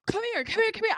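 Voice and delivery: put-on high, urgent call to a hurt child; anxious call pitched high. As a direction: wave him over fast, voice up high. Calling a bleeding kid over.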